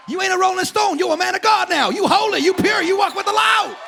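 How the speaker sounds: high, loud preacher building to a shout; rally the church, climbing in pitch; full-force preaching. Real, not acted.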